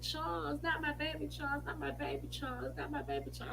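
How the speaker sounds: a hint of a tearful mother's plea; lightly voiced weeping lament, fading; crying mother. Mother lamenting to Charles about her baby, mildly tearful. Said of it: "see my mama crying like that".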